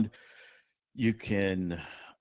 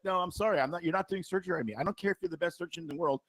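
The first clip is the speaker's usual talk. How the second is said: higher, softer, rapid-fire protest; firm refusal; 6 semitones higher, 5 dB quieter than usual. Acted. Patient refusing an Aussie surgeon, firm high-pitched refusal.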